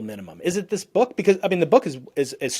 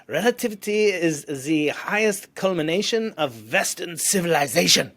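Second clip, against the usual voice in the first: put-on light German accent, slow and a bit higher; a hint of a German accent, slow and raised; 4 semitones higher, slower than usual. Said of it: "in his German accent".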